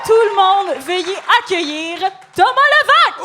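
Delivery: loud announcer intro, slow; grand entrance announcement. Acted. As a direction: boom out the guest's name like a host. Host introduces guest.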